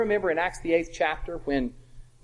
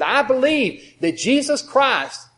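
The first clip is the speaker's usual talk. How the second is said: higher, louder, very slow proclamation; eunuch confessing; 8 semitones higher, 7 dB louder, much slower than usual. Retold or imitated. The eunuch confesses to Philip, slow and raised.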